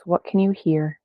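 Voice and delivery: ordinary talk, nothing marked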